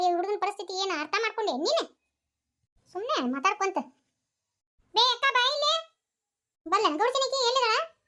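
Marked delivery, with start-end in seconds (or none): 4.9-7.9 higher, louder call across the yard. Acted. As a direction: call her over from a distance. Woman calling out for the mother.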